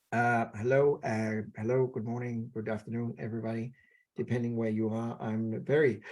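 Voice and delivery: low, soft, yawning delivery with no energy; mock unenthusiastic speaker, quiet and low; bored, low-energy opening. Shown as a demonstration. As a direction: sound like you'd rather be asleep. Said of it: "My volume is very low".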